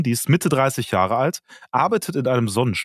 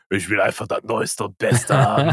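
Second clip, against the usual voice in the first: gieriger Käufer. Retold or imitated. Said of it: "jetzt klingt's so ein bisschen".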